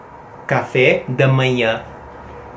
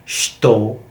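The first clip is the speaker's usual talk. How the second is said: European Portuguese; 4 semitones lower than usual. Shown as a demonstration. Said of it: "In Portugal ... they say".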